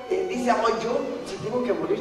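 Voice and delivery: usual speaking voice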